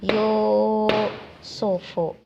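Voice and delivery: Yusufu slowly, u held for two beats; teacher models Yusufu with a long u, chanted; long vowel. Shown as a demonstration. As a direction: lengthen the first u in Yusufu. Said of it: "dua harokat atau dua ketukan".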